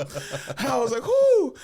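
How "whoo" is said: very high, loud whoop; hyped whoop. Retold or imitated. Boy cheering a roast, loud high whoop. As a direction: whoop like the crowd just got roasted. Crowd reaction in story.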